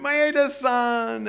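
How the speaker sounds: high, slow, drawn-out call of her name; joyful reunion cry. Retold or imitated. Tom greeting the woman after forty years, a slow high joyful call. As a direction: stretch the name out in overjoyed greeting.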